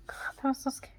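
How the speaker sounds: shaken unvoiced whisper; real frightened whisper. Real, not acted. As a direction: breathe it out, still scared. Still shaken after the scare.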